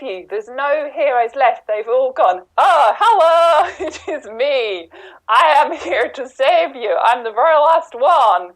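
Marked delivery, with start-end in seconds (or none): 2.6-8.5 high, booming hammed-up hero arriving; heroic rescuer. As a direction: announce yourself like a cheesy action hero. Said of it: "it probably is a bit like this".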